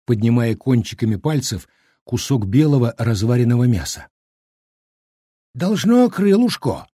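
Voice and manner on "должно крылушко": put-on slow, high-pitched old man's reply; calm old man. A narrator's reading. Old cook explains the strange meat, calm. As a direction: answer him unruffled, drawn out, pitched up. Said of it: "спокойно ответил дед Щукарь".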